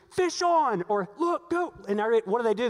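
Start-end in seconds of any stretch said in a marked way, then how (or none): 0.1-0.8 high, loud call of Fish on; excited call. Retold or imitated. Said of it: "Everybody looks around and goes". 1.2-1.7 high, urgent excited cry; sharp thrilled shout on the boat; excited call. Retold or imitated. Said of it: "Everybody looks around and goes".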